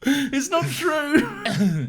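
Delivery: high, drawn-out denial; protesting wail. Real, not acted. Co-host still denying the accusation, high slow wail.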